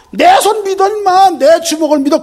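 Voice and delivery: loud, high swaggering brag; boastful shout. Retold or imitated. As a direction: shout it like a cocky tough guy. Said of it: "큰 소리 치면서 가지". (큰소리치는 허세 외침)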